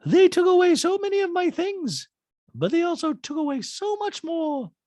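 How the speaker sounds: very high, airy put-on Dorit voice; Dorit impression. Podcaster quoting Dorit in her talking head, high put-on voice. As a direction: squeak it out like a breathy reality star.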